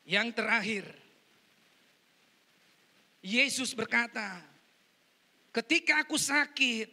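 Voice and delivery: full-force pulpit preaching; loud, emphatic sermon delivery. Real, not acted. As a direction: proclaim it loudly to the whole congregation.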